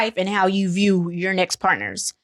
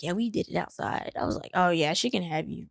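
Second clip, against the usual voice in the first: much softer flat exchange; deadpan; 3 semitones lower, 8 dB quieter than usual. Retold or imitated. Dismissing the boyfriend's boast, soft and deadpan. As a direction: toss it off quietly, unimpressed.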